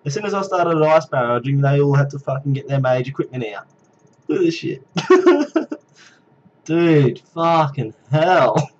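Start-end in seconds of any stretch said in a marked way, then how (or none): 4.9-5.8 squealing high laugh. Real, not acted. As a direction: giggle helplessly. Laughing at copycats.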